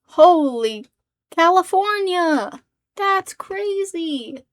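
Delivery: loud, drawn-out astonishment; hammed-up marvelling exclamation; mock amazement. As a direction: blow it up into huge slow awe.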